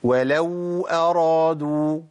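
ولو أرادوا with waw held long; ولو drawn out as an error; wrong long waw. Shown as a demonstration. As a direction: stretch the waw of ولو too far. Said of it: "ده خطأ".

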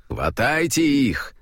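plain reading voice

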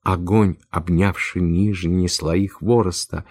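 plain reading voice

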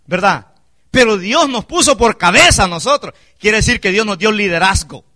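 ordinary talk, nothing marked

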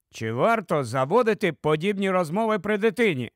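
plain reading voice

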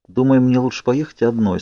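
usual speaking voice

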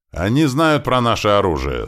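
high, loud warning shout; yelling. Acted. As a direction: yell a warning across to them. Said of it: "снова заорал грач".

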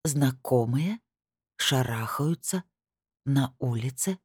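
quiet, halting echo question; hushed, dawning realisation with rising breaks; stunned. A narrator's reading. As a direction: repeat it slowly word by word, breath held. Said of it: "забыла выдохнуть".

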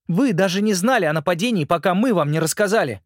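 put-on a boy urgently pleading his case; earnest boy. Simon's lines.

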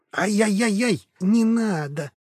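high pitiful whimper; baby animal. Acted. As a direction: whine like a hurt puppy. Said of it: "жалобно заскулил Фло".

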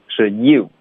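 ordinary talk, nothing marked

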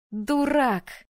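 high, mock-scornful jab; snorted retort. A narrator's reading. Heroine hugs her friend, snorted playful scolding. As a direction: toss it off as a playful insult. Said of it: "фыркнула я".

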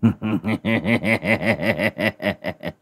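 long staccato gleeful cackle; crowing sorcerer's chuckle; cackling laugh. A narrator's reading. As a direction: cackle in delight at the sky. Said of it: "cười hình hạch".

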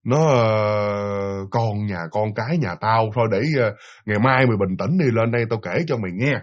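low, subdued and hesitant; saddened boss. Retold or imitated. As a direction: imitate a weary boss gently sending someone home. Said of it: "gương mặt của người chủ đó buồn".

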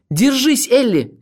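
very high, loud strained cry; breathless shout. A narrator's reading. Olga urges Elli to hold on, gasping shout. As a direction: cry out while gasping for air. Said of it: "крикнула она, задыхаясь".

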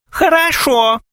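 very high, squeaky mock woman; coquettish squeak. A narrator's reading. Gromov plays beloved accepting suitor, squeaking. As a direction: squeal it flirtatiously. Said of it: "пропищал Громов, кокетливо".

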